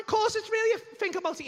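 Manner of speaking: high, loud insistence; indignant insistence. Retold or imitated. Comedian argues with doubting mate, high heated insistence.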